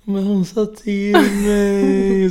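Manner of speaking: put-on high, slow, wailing little-girl whine; imitating a crying child. Mom imitating crying daughter Audrey, a high, slow wailing whine. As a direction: mimic a sulky toddler dragging out every word through tears. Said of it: "Och så börjar hon gråta".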